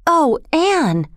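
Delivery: high, relieved cry of her name; light narrator's take on a girl gasping in relief; gasped with relief. A narrator's reading. Diana seeing Anne safe, gasping relief. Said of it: "gasped Diana, crying with relief".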